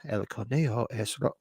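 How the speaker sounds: soft imitated little voice, cut short; hushed catchphrase. Host breaking into the running bit for the chat, hushed. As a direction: murmur the Spanish line like the film's whisper. Repeats the whispered film line.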